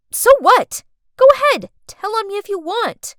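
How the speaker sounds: high loud defiance; defiant outburst. Acted. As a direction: throw it back defiantly.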